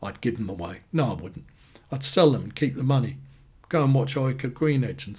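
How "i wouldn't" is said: usual speaking voice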